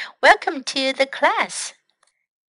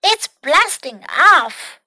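slow, raised, storybook cry; alarmed cry; 5 semitones higher, 5 dB louder, slower than usual. Acted. Snail on a runaway fire extinguisher, alarmed cry. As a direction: cry it out higher and louder, drawn out. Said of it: "Snail cried".

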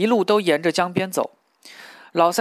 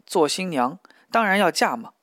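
ordinary delivery

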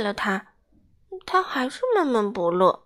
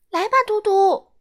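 high, loud call to a friend; 9 semitones higher, 7 dB louder than usual. A narrator's reading. Badou calling Dudu to join hide-and-seek, loud and high. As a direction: shout across the yard to Dudu. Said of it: "巴豆喊道".

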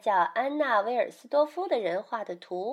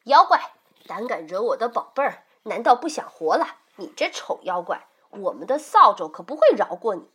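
roaring; 3 semitones higher, 7 dB louder than usual. Acted. Mother confronts the monster for her child, roaring. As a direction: bellow the threat, then attack. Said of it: "妈妈大吼一声".

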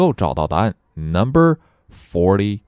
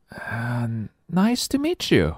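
put-on soft hesitant start, then high child voice; high-pitched young heroine; polite little girl; 4 semitones higher, 4 dB quieter, slower than usual. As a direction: greet shyly as a young girl. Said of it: "says Nellie".

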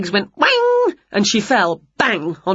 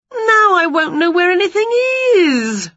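high, loud storybook wail; 11 semitones higher, 4 dB louder than usual. A narrator's reading. Winnie despairs at her tidied kitchen, high wail. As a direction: wail it, pitched right up. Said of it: "wailed Winnie".

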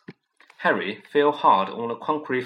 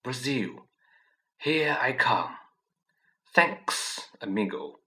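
low hissing snake; slower than usual. A narrator's reading. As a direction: hiss it like a departing snake. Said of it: "a low, hissing voice said".

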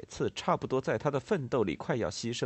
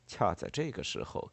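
ordinary delivery, nothing marked

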